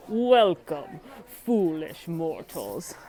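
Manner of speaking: slow, spooky welcome; ghost host voice. Shown as a demonstration. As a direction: drag out foolish mortals like a ghost host. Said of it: "imitate the ghost hostess".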